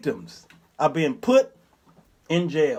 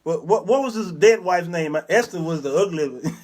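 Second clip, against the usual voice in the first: normal talking voice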